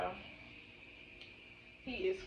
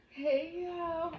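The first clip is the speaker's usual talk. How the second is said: bright high sing-song hello; high squealed greeting; 8 semitones higher than usual. Pretend. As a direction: squeal the hey y'all up high.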